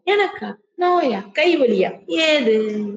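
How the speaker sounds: mock disbelief, high; scoffing sage. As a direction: laugh off the pain. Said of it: "இந்த internal dialogue என்னது".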